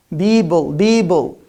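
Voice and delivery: "Bible" as students mispronounce people; voiced B in "Bible" where P belongs. Shown as a demonstration. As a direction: say people wrongly as "Bible", with B sounds. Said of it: "It's wrong".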